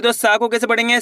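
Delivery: normal talking voice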